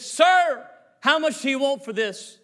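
high, loud hail; eager calling out. Retold or imitated. Old man asking to buy his car back, eager loud call. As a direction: call out urgently to the owner.

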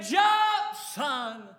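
very loud, high shout; desperate father yelling to his son; yelled up. Retold or imitated. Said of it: "The daddy yelled up".

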